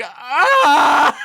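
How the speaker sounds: very high, loud frustrated cry; exasperated yell. Retold or imitated. Alone in her car over the lyric, a loud exasperated shriek. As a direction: let out a sharp anguished shriek.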